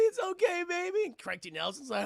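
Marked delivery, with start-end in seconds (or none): 0.0-1.0 high imitated mom soothing her child; comforting mother. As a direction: reassure in a high, put-on mom voice.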